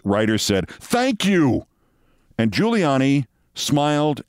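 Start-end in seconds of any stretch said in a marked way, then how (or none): 0.7-1.7 clipped high exasperated thanks; annoyed. Retold or imitated. Deputy mayor exasperated with Giuliani, high annoyed thanks. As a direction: snap it out, fed up. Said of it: "With annoyance".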